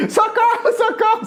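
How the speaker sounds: high, loud, laughing mock cry for help; mock scream of fear. Preacher acting the frightened disciples, a laughing high mock scream. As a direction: ham up the terrified yell while laughing. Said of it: "começam até a gritar de medo".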